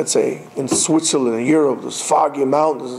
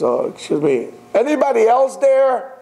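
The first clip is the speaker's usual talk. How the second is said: sheepish punchline; 7 semitones higher than usual. Retold or imitated. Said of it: "the guy says".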